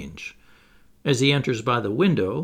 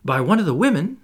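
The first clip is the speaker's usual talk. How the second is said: a bit higher, keen to know; eager; 5 semitones higher, 4 dB louder, faster than usual. A narrator's reading. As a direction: lean in eagerly.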